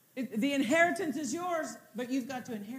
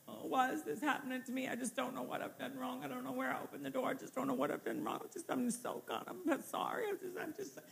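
soft, self-pitying moan, much quieter; preacher mocking a believer who plays the victim, soft and whiny; 6 dB quieter, faster than usual. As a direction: mope like someone feeling sorry for themselves. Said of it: "you're not gonna get any victory talking like that".

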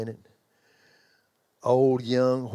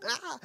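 pain cry; 7 dB quieter than usual. Retold or imitated. Toe popped back into place.

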